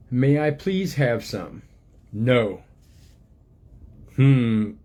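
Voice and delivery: gentle storybook reading voice, unhurried; reading to children. Acted. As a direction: read it like a picture book to little ones. Reads book aloud to children.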